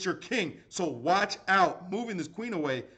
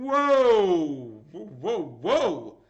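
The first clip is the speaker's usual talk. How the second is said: bowled over, drawn-out whoa; astonished whoa; 7 dB louder, much slower than usual. Real, not acted. Streamer stunned by a viewer's generous gift, astonished.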